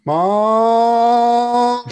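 loud high yell for mom; calling out. Acted. Boy at the door shouting for his mother, loud yell. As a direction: shout for mom across the house.

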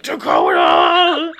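low, slow drawn-out yell of a name; mock yell. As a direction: bellow the name deep and slow. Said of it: "He's gonna be yelling Dakota".

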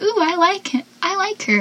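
high, soft infatuated cooing; lightly mocking, high-pitched swoon; mock gushing. Voicing friend smitten with new girl.

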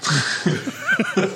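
high-pitched burst of laughter; real laughter. Real, not acted. Co-host reacts to the boss story, real laughter.

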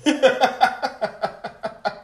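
real laugh in quick pulses; staccato chuckle. Real, not acted. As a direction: let out a long, stuttering laugh. Preacher laughs between statements.

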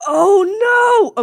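loud, high-pitched cry of dismay; shocked dismay. Real, not acted. Co-host realizes it's Michael from the last episode, loud shocked dismay.

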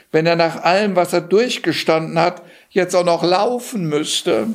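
put-on high, sweet girlish plea to her father; a smitten princess coaxing her father; princess pleading. Princess begging her father the king to drive Johann home, high and sweet.

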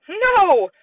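high, loud, defiant no; child yelling over a toy; toddler shout. Acted. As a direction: shout it like a cross little boy. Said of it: "Fudge shouted".

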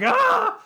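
high, loud laughing yelp; laughing startled shriek. Retold or imitated. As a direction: squeal it as if grabbed. Character yelps, scampers off.

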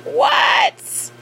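high-pitched incredulous yelp of disbelief; retold shock. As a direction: shoot the pitch way up in outraged disbelief. Retold shocked reaction to the pictures.

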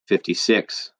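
empty, expressionless answer; numb, just stating the number; said blankly. A narrator's reading. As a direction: Eddie answering blankly, lost in thought. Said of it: "Eddie said blankly".

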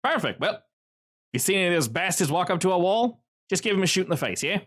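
casual order from a shady host; Varys the spymaster. Acted. GM voices Varys giving orders.

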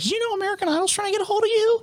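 high, fast excited mom voice. Retold or imitated. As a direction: squeal it like an excited mother. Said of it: "she called me and she was like".

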